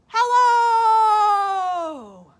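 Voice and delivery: high, loud shout from the peak; a big shout to hear the echo. Acted. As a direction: shout it from the mountain top. Bear shouting from mountain top.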